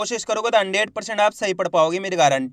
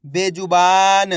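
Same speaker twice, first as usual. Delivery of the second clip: बेजुबान said slowly and a bit louder; slow careful reading; 4 dB louder, much slower than usual. Shown as a demonstration. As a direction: stretch बेजुबान out clearly. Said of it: "अब चलिए आगे देखिए". (बेजुबान धीरे और साफ़)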